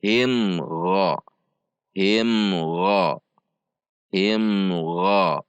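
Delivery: "Him Gha" connected, the m clear and briefly hummed; joined izhar. Shown as a demonstration. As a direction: run "Him" smoothly into "Gha". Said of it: "baca disambung dengan sifat izhar". ("Him Gha" disambung dengan izhar)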